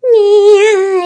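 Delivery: gasping scream into a loud yell; panicked gasp and shout; terrified scream. Real, not acted. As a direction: shriek at the jump scare. Jump scare by Slender.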